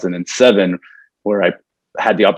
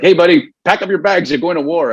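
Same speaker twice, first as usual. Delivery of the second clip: mock buddy alarm; 9 semitones higher than usual. Buddy's call retold.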